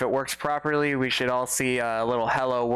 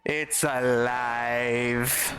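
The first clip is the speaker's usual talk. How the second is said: even slower, drawn-out mock Frankenstein; mad scientist; much slower than usual. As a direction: drag each word like a gloating mad doctor. Repeat of bot revival quote.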